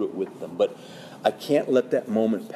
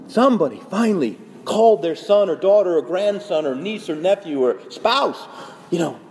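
dramatic urgency; 6 semitones higher than usual. Real, not acted. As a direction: plead like time is running out. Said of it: "I'm kinda coming across very dramatic today".

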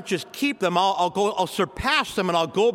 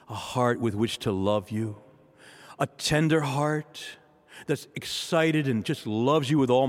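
heartfelt prayer; 4 semitones lower than usual. Acted. Priest leads the church in prayer to the Lord, pleading and earnest. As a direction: beg God humbly, as if kneeling.

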